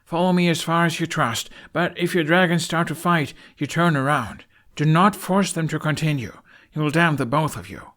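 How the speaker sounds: high, loud orders; commanding, urgent instructions; firm command. A narrator's reading. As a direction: give firm orders to the riders. Hiccup commands the riders.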